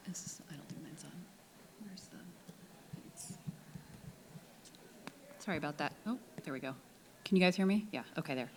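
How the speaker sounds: hoarse, half-whispered losing voice; straining a voice that's almost gone. Real, not acted. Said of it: "Sorry, I'm losing my voice".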